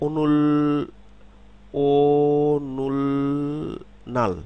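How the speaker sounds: অনুল stretched very slowly and kept apart; syllable by syllable. Shown as a demonstration. Teacher sounding out অনুল slowly before joining it. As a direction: draw out অনুল slowly, one syllable at a time. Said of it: "শব্দে যখন আমরা পড়ব তখন কি".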